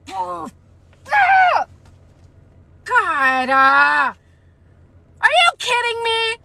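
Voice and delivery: high shrieking outrage; screaming. Real, not acted. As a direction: scream it in disbelief.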